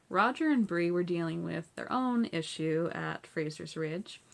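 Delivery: ordinary delivery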